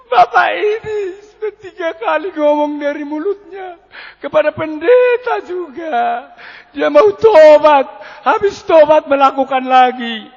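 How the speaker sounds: loud, high, drawn-out mock wail; imitated hysterical crying. Wife accusing her husband to the pastor, hysterical wail. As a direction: wail each phrase high and long. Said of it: "Ini kok histeris terus".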